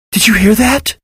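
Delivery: put-on high, eager child's question; bursting with excitement at the sound; excited question. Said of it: "Nesta asked excitedly".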